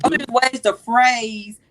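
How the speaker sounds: ordinary talk, nothing marked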